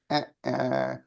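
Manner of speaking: imitating a worn-out voice that can barely sing; mock strained singer's voice with nothing left; tired failing voice. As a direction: croak out a failing, exhausted voice. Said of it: "The voice was going".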